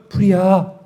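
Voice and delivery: drawn-out, half-hearted call of fire; an emergency cry with no urgency; mock feeble alarm. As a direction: pretend to raise the alarm far too mildly.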